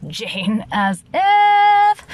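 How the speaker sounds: high, drawn-out sarcastic scoff; mock scoff. Real, not acted. Vlogger mocking her own plain Jane line, scoffing.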